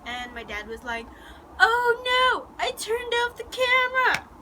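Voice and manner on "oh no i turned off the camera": high, loud mock dismay; dismayed dad. Dad realising he missed the moment, dismayed. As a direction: imitate a dad's exaggerated horror.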